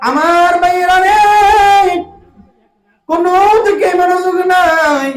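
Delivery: high, loud sung cry with long held vowels; ringing congregation call. Real, not acted. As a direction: call out over the whole crowd. Calling out to the congregation. (উঁচু গলায় টেনে ডাক)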